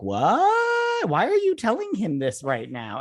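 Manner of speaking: sudden very high yelp of disbelief; shocked. Retold or imitated. Host reacting to the character's line, shocked yelp. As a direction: jump up in pitch, aghast.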